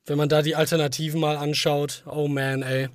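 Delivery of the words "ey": ordinary delivery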